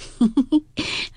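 替薛蟠演出一阵打趣的短笑; 见人脸上带伤时的打趣轻笑; 笑；闷声轻笑一串. A narrator's reading. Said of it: "笑道".

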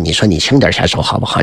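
plain reading voice

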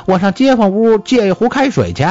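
high, fast wife's voice; village wife. A narrator's reading. Wife offering to borrow hot water, high quick voice. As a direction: pitch it high and rattle it off.

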